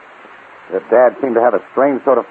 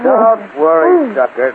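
slow sneering taunt; mocking taunt; 13 semitones higher, much slower than usual. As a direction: sneer at the captive. Villain taunts captive.

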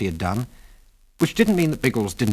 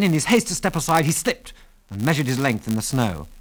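ordinary delivery, nothing marked

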